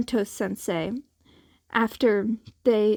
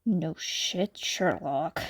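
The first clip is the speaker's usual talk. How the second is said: deadpan; 5 semitones lower, 4 dB quieter, slower than usual. Acted. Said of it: "answered him with a deadpan".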